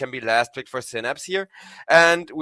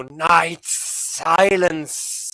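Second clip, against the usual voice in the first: slow, growled monster voice; scary Night Stalker voice; much slower than usual. Acted. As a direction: drag it out like a menacing beast. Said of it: "your scary Night Stalker voice".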